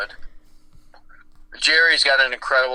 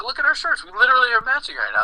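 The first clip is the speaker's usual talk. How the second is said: high-pitched retold excitement, speeding up; imitated excited granddaughter; 11 semitones higher, faster than usual. Granddaughter showing grandpa their matching shirts, imitated excitement. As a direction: mimic her eagerness in a high, quickening voice.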